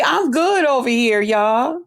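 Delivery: ordinary talk, nothing marked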